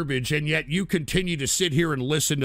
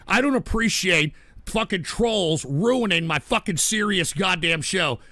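usual speaking voice

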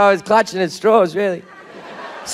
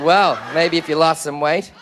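snide seatmate; 7 semitones lower than usual. Retold or imitated. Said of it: "And he said".

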